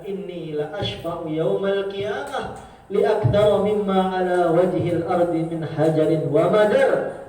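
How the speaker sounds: slow, loud recited Arabic; hadith recitation. Acted. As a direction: recite it slowly and firmly. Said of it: "Nabi mengatakan".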